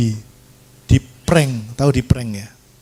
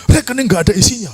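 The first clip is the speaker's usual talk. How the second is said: higher mock-indignant repeat; retold angry complaint; 8 semitones higher, 3 dB louder, faster than usual. Worker back from the ATM complains to pastor, mock angry. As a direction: complain in a raised pitch, half amused. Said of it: "Pulang marah-marah".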